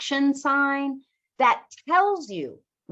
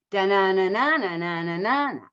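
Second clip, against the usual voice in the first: da-na-na imitation of English speech rhythm; rhythm syllables; 3 semitones lower, faster than usual. Shown as a demonstration. Teacher showing English stress timing, rhythmic da-na-na. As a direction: tap out the sentence rhythm in nonsense syllables.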